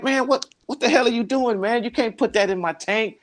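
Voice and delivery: mock outrage, like a friend caught off guard; upset friend. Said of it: "he was still upset".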